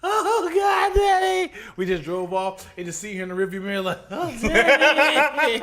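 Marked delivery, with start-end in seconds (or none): 0.0-1.5 put-on high, crying little girl; mock child wailing. Daughter wailing as dad drives off, high mock cry. 4.1-5.6 slow, high, drawn-out whimper; mock wail. Daughter crying in the rearview mirror, slow mock wail.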